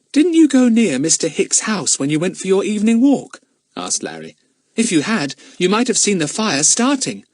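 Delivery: high, loud schoolboy voice; keen young detective; questioning boy. Acted. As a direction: press the question eagerly in a high child's voice. Plays Larry questioning.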